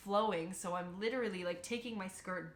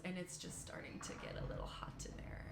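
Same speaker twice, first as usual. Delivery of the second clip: whisper; 10 dB quieter than usual. Real, not acted. Whispered detail in sauna story.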